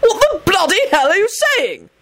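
high-pitched furious yell; screamed outrage. A narrator's reading. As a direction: scream it, appalled at the accusation. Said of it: "Arthur screamed".

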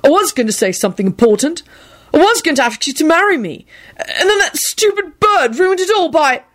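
insistent, exasperated, ending very high; vehement. A narrator's reading. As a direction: protest forcefully, building in pitch. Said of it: "Neville rejoined vehemently".

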